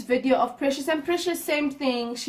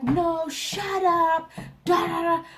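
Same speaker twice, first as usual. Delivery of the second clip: retold outburst, high and drawn out; mock screaming match; 5 semitones higher, slower than usual. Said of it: "the screaming match that happened".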